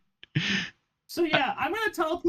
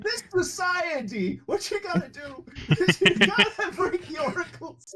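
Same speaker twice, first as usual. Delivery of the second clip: higher-pitched, hammed-up pundit impression; Jordan Peterson; 5 semitones higher than usual. Player imitating a lecturer to win a persuasion roll, hammed-up impression. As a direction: rant like a worked-up public lecturer, half laughing. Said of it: "Use your best Jordan P-, Jordan B. Peterson voice".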